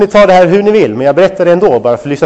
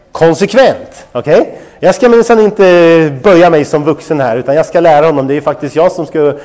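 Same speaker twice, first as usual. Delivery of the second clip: ordinary talk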